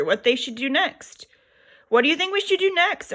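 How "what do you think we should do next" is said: high, quick teasing question; mother asking back. A narrator's reading. Mother turning the question back to her lambs, high and quick. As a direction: toss the question back to them. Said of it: "asked their mother".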